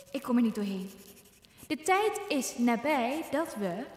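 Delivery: solemn, soft speech to the nation; queen's wartime broadcast; royal radio address. Acted. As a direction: address the nation with quiet gravity.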